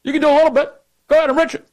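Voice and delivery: high, careless mock permission; mocking Obama. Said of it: "He said".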